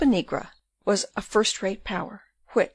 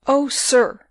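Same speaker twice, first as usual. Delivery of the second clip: higher, louder exclamation; crying out; 5 semitones higher, 6 dB louder than usual. A narrator's reading. Rosa to the burgomaster, crying out in appeal. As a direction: cry it out in appeal. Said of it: "cried Rosa".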